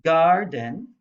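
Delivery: garden with a loud, strong GAR; stress on first syllable. Shown as a demonstration. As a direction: punch the start of "garden". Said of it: "the stress is at the beginning".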